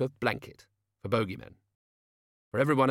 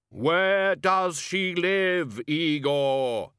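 slow, high otherworldly command; granddad's voice; 7 semitones higher, 4 dB louder, much slower than usual. Acted. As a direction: borrow Death's commanding voice. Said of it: "you don't try your granddad's voice on me".

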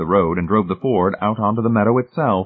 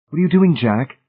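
sudden question; 7 semitones higher, 4 dB louder than usual. A narrator's reading. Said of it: "said suddenly in his ear".

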